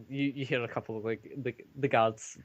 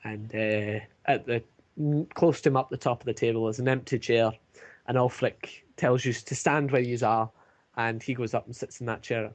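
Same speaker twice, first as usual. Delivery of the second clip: ordinary delivery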